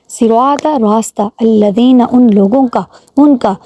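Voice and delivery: ordinary delivery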